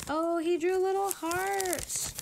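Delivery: slow, high, sweet coo. Real, not acted. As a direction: gush over the little heart. Cooing over a drawn heart.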